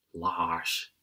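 low, sighing reproach; sighed. A narrator's reading. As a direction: sigh the name at a brother. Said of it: "zucht mijn zus".

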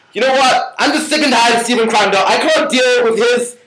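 a mock meltdown, laughing through it; fed-up outburst. Roommate ranting about Stephen, high and half-laughing.

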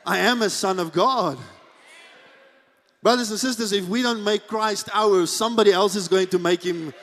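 usual speaking voice

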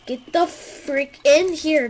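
frustrated shout. Real, not acted. Frustrated gamer.

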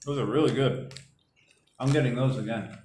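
talking between bites; chewing while talking. Real, not acted. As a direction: mouth partly full. Seen 0: Man ate snack just before; lips pursed, jaw chewing while speaking.

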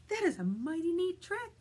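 high-pitched storybook dog; dog's admiring thought. Acted.

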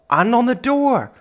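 high, loud alarmed exclamation; shocked gamer shriek; shocked cry. Real, not acted. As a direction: blurt it out in horror. Shocked at creepy door.

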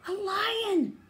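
high, loud startled shout; startled cry. Acted. Little hyena suddenly spotting a lion, high loud startled cry. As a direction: gasp it out in shock.

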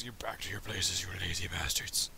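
low hissed whisper; whispered insult. Pretend. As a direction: mutter the insult under your breath.

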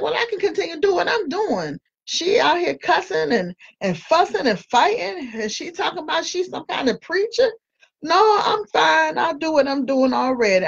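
high, scoffing voice of an unimpressed onlooker; mocking skeptic. Onlookers scoff at a cussing, fighting preacher in a high mock voice. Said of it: "they gonna be like".

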